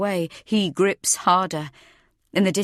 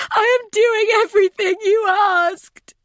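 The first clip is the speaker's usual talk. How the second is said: very high, loud desperate wail; frantic high-pitched protest; desperate cry; 8 dB louder than usual. A narrator's reading. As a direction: scream your plea in terror. Desperate plea to her attacker.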